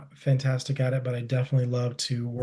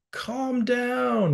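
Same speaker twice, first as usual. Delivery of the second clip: high, drawn-out mock scolding; 10 semitones higher than usual. Showing what not to say to a tense partner, mock scolding. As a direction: tell them off in a pitched-up voice. Instead of being like.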